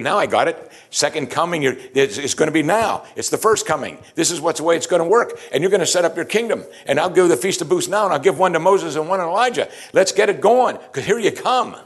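high, loud and rushing with excitement; an overeager disciple making plans; eager Peter. Retold or imitated. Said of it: "Peter's probably thinking".

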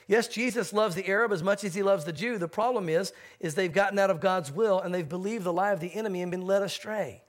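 ordinary delivery

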